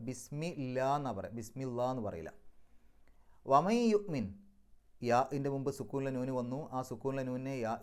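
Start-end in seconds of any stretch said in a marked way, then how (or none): 3.4-4.2 chanted, raised recitation of വമൈ യുഅ്മിൻ; Quranic recitation. Shown as a demonstration. Teacher intones the verse for the class, louder. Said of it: "recites verse for class".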